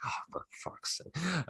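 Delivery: breathy whispered curse, barely voiced; mock whisper. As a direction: imitate a disgusted rival striker under his breath. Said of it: "Danny Ings watched that goal and I was like".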